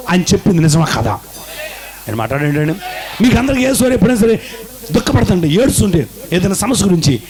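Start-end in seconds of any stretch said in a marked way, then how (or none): 3.2-7.2 fast, much higher preaching call; fervent preaching. Acted. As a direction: push it up fast to the congregation. Preacher raises voice to crowd. (ఉద్వేగంగా వేగంగా బోధించడం)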